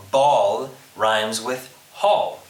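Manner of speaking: "ball" and "hall" set apart and punched; slow, loud stress on the rhymes "ball" and "hall"; rhyme demonstration. Shown as a demonstration. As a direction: pause round "ball" and land firmly on "hall". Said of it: "Rhyme is the repetition of vowel and consonant sounds".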